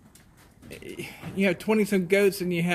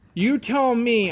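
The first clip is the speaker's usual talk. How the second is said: louder, higher angry retort at the cop; yelled back angrily; 5 semitones higher, 4 dB louder, slower than usual. Retold or imitated. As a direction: snap it back at him, fed up. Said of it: "I yelled at him back".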